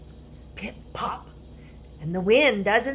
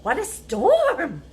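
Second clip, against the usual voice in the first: wren twittering; 7 semitones higher, 5 dB louder than usual. Acted. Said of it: "twitters wren".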